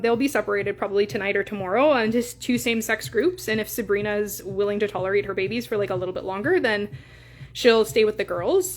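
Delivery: ordinary talk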